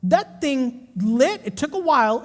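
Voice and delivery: ordinary talk, nothing marked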